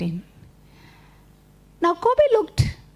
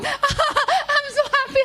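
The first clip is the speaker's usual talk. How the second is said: delighted laughing; 11 semitones higher, slower than usual. Acted.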